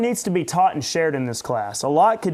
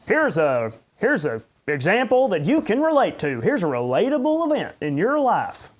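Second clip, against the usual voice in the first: higher, sing-song folksy sermon opener; mock classic preacher; 6 semitones higher, 3 dB louder than usual. Pastor mocking old-style preachers' relatable openers, sing-song. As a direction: ham up the corny relatable intro. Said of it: "a classic old style pre".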